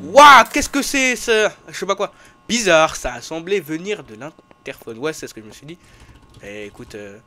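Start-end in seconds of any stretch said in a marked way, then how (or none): 0.0-2.1 alarmed at an eerie sound; startled. Real, not acted. Strange noise in game.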